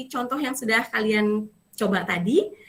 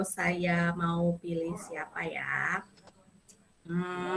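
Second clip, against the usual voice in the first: whisper; 5 semitones lower, 8 dB quieter, much slower than usual. Real, not acted. Teacher musing to herself.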